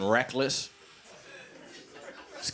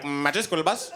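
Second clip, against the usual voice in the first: imitated Indian kid's accent; lightly put-on Indian accent, hesitant opening hum; 5 semitones higher than usual. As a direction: kid caller with an Indian accent. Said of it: "there'd be the one Indian kid".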